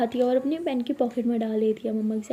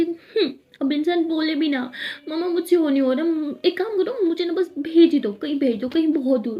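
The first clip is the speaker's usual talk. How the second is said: over-acted crying; 5 semitones higher than usual. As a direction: beg tearfully, overplaying it. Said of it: "ये over acting".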